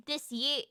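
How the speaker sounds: "year" without its final R; imitated clipped-off R. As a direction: drop the R at the end of "year". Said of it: "I remember they go".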